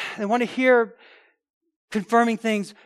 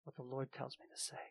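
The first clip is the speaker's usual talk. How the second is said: hushed prophet; 5 semitones lower, 15 dB quieter than usual. Retold or imitated. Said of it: "Micaiah said".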